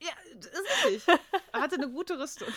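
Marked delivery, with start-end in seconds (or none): 0.0-1.1 laughing. Real, not acted. Laughs while agreeing.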